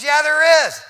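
acted angry shout, very high and loud; mad retort. Dying dad contradicting his daughter about the door, mad shouting. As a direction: yell back in indignant contradiction. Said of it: "And he got mad".

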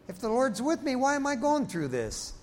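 high-pitched mock complaint to God; acted-out plaintive doubt; plaintive questioning. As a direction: ask it high, like a baffled believer.

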